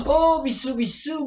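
high, loud air-kisses; mock French kisses. Doctor blowing kisses goodbye, hammed-up French. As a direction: blow kisses to the audience. Kiss noises.

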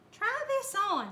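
high, loud urging call; eager cry. A narrator's reading. Miss Lurleen runs over to Bubba with the boot, crying out. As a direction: cry it out, pitched well up. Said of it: "she cried".

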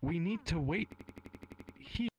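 put-on high, trembling little girl voice; quivering child voice. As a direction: speak like a scared little girl. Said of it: "with a quivering voice".

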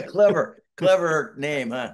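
sarcastic clever clever name. Real, not acted. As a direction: tease the name you hate. Mocking the album title.

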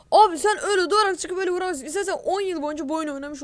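high, loud, fast protest; real indignant rant; indignant accusation. Real, not acted. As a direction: accuse the cheater in disbelief. Accusing the enemy player.

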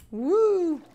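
high, loud "woo"; excited whoop. Real, not acted. Streamer getting carried away in the game, excited whoop. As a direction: let out a sudden excited whoop. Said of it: "I got a little excited".

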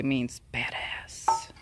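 slow, secret whisper of a naughty word; slowed-down hushed aside; whispered cheekily. Pretend. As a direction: whisper it like you're not supposed to say it. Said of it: "Did I say it?".

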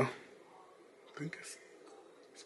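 soft aside whisper. Real, not acted. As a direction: whisper it to yourself. Muttered aside.

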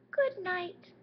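very high tiny mouse voice; little mouse voice. Acted. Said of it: "The little mouse says".

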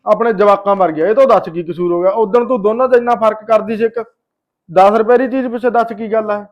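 loud, heated telling-off; angry scolding. Acted. Husband berates wife for mistreating his sister, angry scolding.

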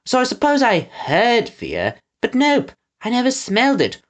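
high-pitched henchman rambling on; Pickles, oblivious. A narrator's reading.

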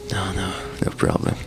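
normal talking voice

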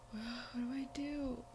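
normal talking voice

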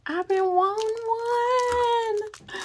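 very high, slow, delighted squeal. Real, not acted. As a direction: squeal it like a dream come true. Squealing over wanted item.

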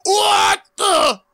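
high loud cry of shock; startled cry. Acted. Villain startled as a vibration nearly floors him. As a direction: jolt out the words.

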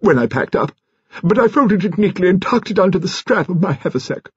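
high-pitched lofty explanation; grand old lady's high voice; rather grand. A narrator's reading. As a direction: keep it lordly and high, an old grande dame. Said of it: "said Lady Lacklander rather grandly".

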